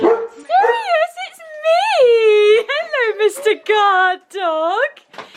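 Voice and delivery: high, squealy pet-talk voice; excited dog greeting. Real, not acted.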